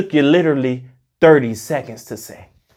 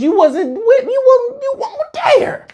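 squeaky laugh. Real, not acted. Ranting about fake friends.

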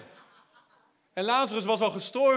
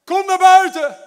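shouting Lazarus's name, high and loud; loud call; 8 semitones higher, 14 dB louder, slower than usual. Acted. Jesus shouting into the tomb at Lazarus, commanding call. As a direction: call him out of the grave at full voice. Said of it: "riep met luide stem".

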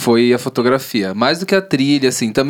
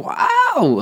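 amazed wow. Real, not acted. Awed by the cinematography.

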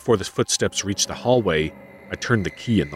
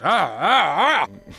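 frightened gasps; 9 semitones higher, much slower than usual. Acted. Narrator frightened, hiding in panic.

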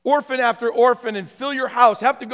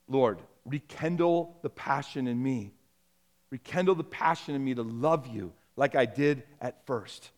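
low, soft prayer to God; hushed, earnest plea; modelled prayer; 7 semitones lower, 9 dB quieter than usual. Acted. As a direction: pray it quietly, as an example for the congregation.

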